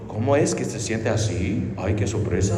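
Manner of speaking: a low, quiet sarcastic "what a surprise"; mock surprised God. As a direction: play God pretending to be shocked, ironically. Mocking God's pretend surprise.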